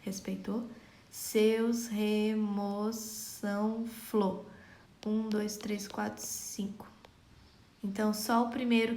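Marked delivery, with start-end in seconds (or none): 1.1-4.4 very slow, stretched scansion of the verse; syllable by syllable. Shown as a demonstration. Teacher counting a verse's syllables for students, very slow. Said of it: "Só comprovando aqui". 5.1-6.9 softly counting syllables under the breath; murmured counting. Shown as a demonstration. Teacher counting syllables to herself, fading to a whisper. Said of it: "Só comprovando aqui".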